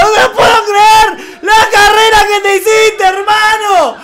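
very high, sustained screaming; ecstatic goal screaming. Real, not acted. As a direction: scream in disbelief, stretching the words. Festeja gol increíble en vivo. (gritos agudos de gol, desaforado)

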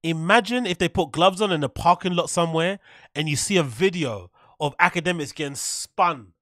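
ordinary talk, nothing marked

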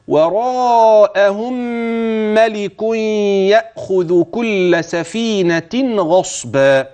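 slow drawn-out chanting; slow Quranic recitation. Shown as a demonstration. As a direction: chant it with long measured vowels. Recites the verse.

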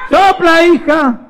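high, loud shout to a daughter; exasperated mum's high yell; mother calling out. Acted. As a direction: call it out sharply from another room. Mother shouts back to burnt María.

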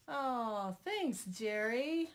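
loud, drawn-out mock gratitude; sarcastic thanks. Real, not acted. Reacts to husband off camera.

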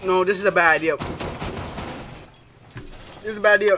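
talking through a burning mouth; words strained by hot wings; mouth burning from spicy food. Real, not acted. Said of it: "Oh, my mouth is burning".

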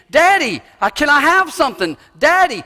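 put-on high, loud childlike pleading; little child calling daddy. Little kid calling out to his daddy, high and eager. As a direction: play a small child calling his dad. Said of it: "little child's word of the most intimate endearment".

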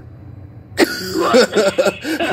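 high burst of real laughter; cracking up at the joke; big laugh. Real, not acted. Laughing hard at the meme punchline.

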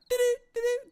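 very high, repeated eerie sound; imitated horror-movie noise. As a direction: mimic a creepy sound effect, high-pitched. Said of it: "It was like something out of".

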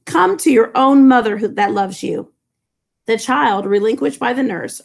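ordinary delivery

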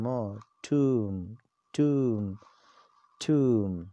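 ٹوم said TOOM with no B; tomb. Shown as a demonstration. Teacher models tomb as TOOM for viewers. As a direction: repeat ٹوم as TOOM, the B silent. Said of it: "the same pronunciation in both the countries".

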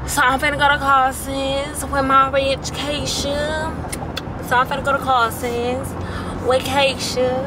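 badly put-on accent imitating JT; JT's accent. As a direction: play with an exaggerated JT accent. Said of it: "that's ugly".

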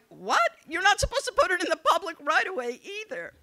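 loud, rapid, high mock scolding; exasperated retort. Candidate correcting husband about the signs, acted exasperation. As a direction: fire back in acted disbelief.

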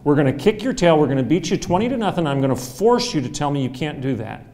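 loud mock challenge, pushing hard; loud, cocky trash talk; defiant taunt. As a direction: taunt them like a cocky rival. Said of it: "I said".

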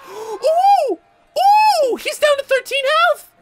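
very high, loud whooping; excited squeal. Real, not acted. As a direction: gasp and squeal with sudden hope. Boss suddenly nearly beaten.

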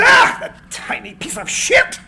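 high, sudden angry shout; a furious cry about the manager; angry outburst. Acted. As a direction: spit out the insult in a fury.

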